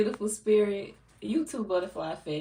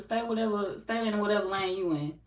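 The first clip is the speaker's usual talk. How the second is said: usual speaking voice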